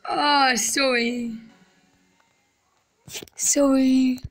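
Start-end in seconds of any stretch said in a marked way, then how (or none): 3.4-4.2 whispering. Real, not acted. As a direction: breathe the word out. Apologizing quietly.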